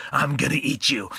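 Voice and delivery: ordinary delivery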